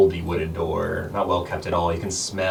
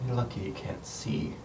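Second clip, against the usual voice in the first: whisper; 5 semitones higher, 7 dB quieter than usual. Acted. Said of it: "Celine whispers to you once more".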